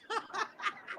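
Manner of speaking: a high airy cackle; breathy high laugh. Real, not acted. Teasing Shaka about her next husband, airy laugh.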